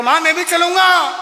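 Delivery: put-on high, loud little boy; child's loud plea. Seven-year-old begging mother to come, high shout. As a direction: shout it like a stubborn kid.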